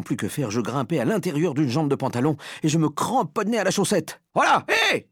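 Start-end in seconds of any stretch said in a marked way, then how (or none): 4.3-5.1 high, loud exclamations; startled yelling. A narrator's reading. Man feeling a mouse in his trousers, yelling. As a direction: yelp out in sudden alarm. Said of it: "cria l'homme".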